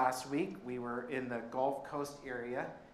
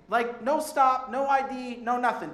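excited disbelief; 9 semitones higher, 9 dB louder than usual. Real, not acted. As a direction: savour each no with delight. Excited telling of the easy entry.

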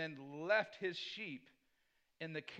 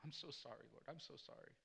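hushed, contrite retelling of weeping; very soft, subdued plea for forgiveness; quietly remorseful, with only a touch of tears; tearful apology; 3 semitones lower, 14 dB quieter than usual. Retold or imitated. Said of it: "And I began to weep".

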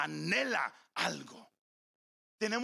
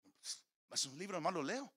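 indifferent reply; 3 semitones lower, 8 dB quieter than usual. Retold or imitated. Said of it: "¿Sientes fuego cuando lees la Biblia?".

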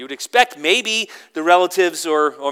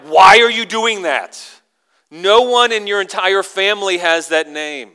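loud, higher, indignant objection; acted family outrage, pitched up; upset relatives; 5 semitones higher, 10 dB louder than usual. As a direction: protest the choice loudly. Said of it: "they kinda get upset".

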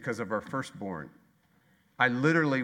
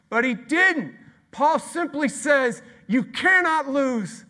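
high, loud, slow; ringing sermon declaration; loud preaching climax; 13 semitones higher, 10 dB louder, slower than usual. Real, not acted. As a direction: proclaim it slowly and loudly.

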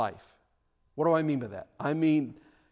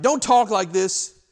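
Peter's loud objection; 6 semitones higher, 10 dB louder, slower than usual. Retold or imitated. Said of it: "but Peter said".

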